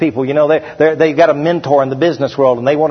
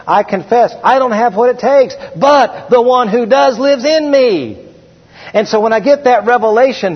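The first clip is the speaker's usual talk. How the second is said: preaching at full force; 8 semitones higher than usual. Acted. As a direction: preach it from the chest.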